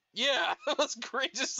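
slow, laughing through it; chuckling while talking. Real, not acted. Laughing at the memory.

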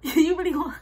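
real laugh breaking into the words. Real, not acted. Storyteller cracking up at sister's demand to fight, laughing.

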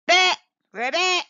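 loud, high croaking ribbit; loud chorus of frogs; frog croaks. A narrator's reading. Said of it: "said the frogs".

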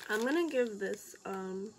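chewing while talking; talking between bites; mouth full. Real, not acted. Seen 1: Hand puts cookie to mouth while speaking, bag held.

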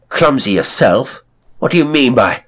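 seething, throw it back at him; loud indignant outburst; indignant rage. A narrator's reading. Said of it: "liberated in the form of rage".

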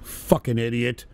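ordinary talk, nothing marked

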